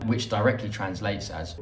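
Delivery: usual speaking voice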